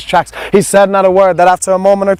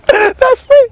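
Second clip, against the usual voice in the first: much higher, laughing through the words; much slower than usual. Acted. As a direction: laugh it out, nearly crying. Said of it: "point and laugh and say".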